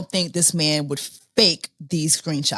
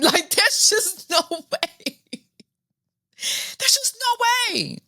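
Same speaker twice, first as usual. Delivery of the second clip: high, laughing can't-believe-it; laughing disbelief; 13 semitones higher than usual. Real, not acted.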